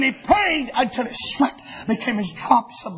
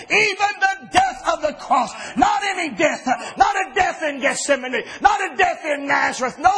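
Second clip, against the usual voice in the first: driving, raised preaching cadence; fiery preaching; 5 semitones higher than usual. Acted. Preacher declaring Jesus' destiny to the church, fiery cadence.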